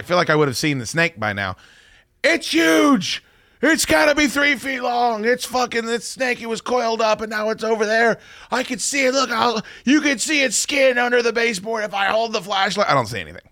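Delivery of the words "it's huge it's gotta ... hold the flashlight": panicked wife. Retold or imitated. Wife insisting to her husband she saw it, high mock panic. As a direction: play the frantic wife insisting. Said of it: "wife insisting on the snake".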